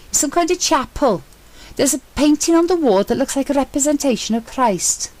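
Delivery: an eager explorer calling up from a hidden chapel; Sentry's excited voice. A narrator's reading.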